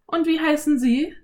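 put-on high girl voice, smiling; a smiling little girl answering; Anne, smiling. A narrator's reading. Anne asks. (Mädchenstimme, lächelnd)

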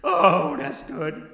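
usual speaking voice

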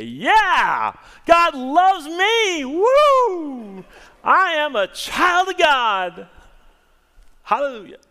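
high, loud and slow exultant cry; shouter. Retold or imitated. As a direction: shout it like a thrilled believer. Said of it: "some people who are shouters".

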